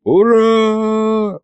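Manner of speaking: long, high hurrah; high drawn-out mock crowd yell; crowd cheer. A narrator's reading. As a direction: cheer it out on one sustained note. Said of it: "завопила площадь".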